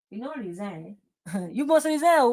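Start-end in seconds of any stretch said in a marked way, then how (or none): 1.5-2.3 laughing taunt. Real, not acted. Laughing jab at Nima.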